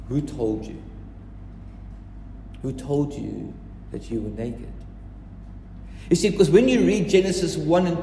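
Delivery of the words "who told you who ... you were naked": softer, lower and unhurried; hushed repeat. Shown as a demonstration. Preacher repeats God's question to the congregation, hushed and weighty. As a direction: let the question sink in quietly. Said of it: "super powerful question when God asks".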